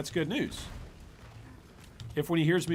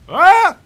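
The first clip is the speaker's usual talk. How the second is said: sudden loud high yelp; short, high scream of shock; startled yell; 22 dB louder than usual. Real, not acted. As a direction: cry out in alarm at a big hit. Startled by a big hit.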